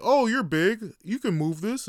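sarcastic mock of a pushy boss. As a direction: toss it off like a pushy manager. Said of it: "they're just like".

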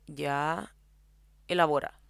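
imitating a saintly listener, low and unamused; straight-faced patience. Edu asking his distressed friend to explain, straight-faced. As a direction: take it seriously, without a trace of laughter. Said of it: "sin cagarse la risa".

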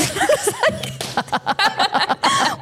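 high, long real laugh; big laugh. Real, not acted. Recalling the deputy story, high real laughter.

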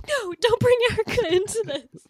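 high, loud imitation of mad Mindy; mock angry Mindy. Imitating Mindy yelling at the TV, mock angry. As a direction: throw it up high like an annoyed protest. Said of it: "Mindy gets so mad".

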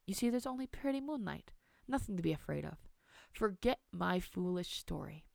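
softened grandmotherly comfort; soothing reassurance. Acted. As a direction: coax a scared child to calm down. Grandmother reassures child in the dark.

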